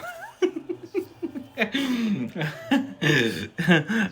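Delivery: high, drawn-out burst of laughter; long real laugh. Real, not acted. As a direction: crack up for several seconds. Laughs at his own remark.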